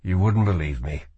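brushing it off; dismissive. Acted. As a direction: wave the question away. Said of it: "he said dismissively".

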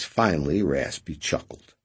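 plain reading voice

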